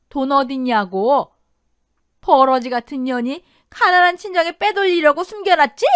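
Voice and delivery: high, loud, furious tirade; raging mother-in-law shouting abuse; yelling in rage. Acted. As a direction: rant at her at full voice. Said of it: "이를 드러내며 소리를 질렀다더군요".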